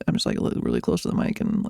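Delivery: low breathy sleepy murmur. Shown as a demonstration. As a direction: sound like you just rolled out of bed. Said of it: "my super sleepy voice".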